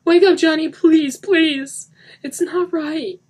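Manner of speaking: high, loud sobbing pleas; desperate pleading. Acted. Denny begging dead Johnny, desperate. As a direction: beg him to wake.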